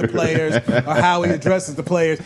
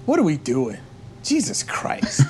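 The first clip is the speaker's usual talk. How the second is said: Bill Belichick; 2 semitones lower than usual. Retold or imitated. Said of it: "he did this Bill Belichick thing".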